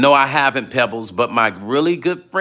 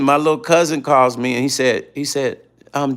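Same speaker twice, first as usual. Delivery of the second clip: normal talking voice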